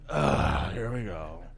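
low, weary sigh of resignation; exasperated groan. Acted. Fisherman grudgingly obeying a silly order, exasperated. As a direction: groan it with an audible eye roll. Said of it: "with an eye roll".

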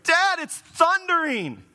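put-on high, slow little kid's plea; scared kid. Kids at night telling dad, whiny child voice. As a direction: imitate a child frightened by thunder. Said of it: "my kids come to me sometimes at night and they're like".

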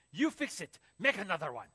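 loud, barked orders; angry yelling. Retold or imitated. Louis orders teen to redo omelet, angry yell. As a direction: shout it like a fed-up boss. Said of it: "So he's screaming at me".

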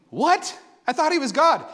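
surprised friend. Retold or imitated. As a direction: sound truly taken aback. Sam reacts with surprise.

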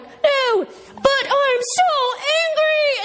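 high, drawn-out mock fury; hammed-up angry cartoon townsfolk. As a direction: wail it out in comic exaggerated anger. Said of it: "when they get angry".

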